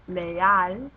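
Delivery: "leal" with a loud AL; stress on last syllable of leal. Shown as a demonstration. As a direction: punch the AL in "leal". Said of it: "the emphasis should go on the last syllable".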